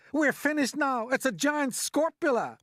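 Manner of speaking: high, loud wail of doom; panicked yell. A narrator's reading. Fire chief facing a giant monster, yelling in panic. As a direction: yell as if all is lost. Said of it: "yelled the head of the fire department".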